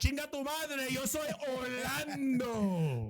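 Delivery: hammed-up Spanish, stretching out the last word; Spanish-accented boast. Host playing his character announcing himself, hammed-up Spanish boast.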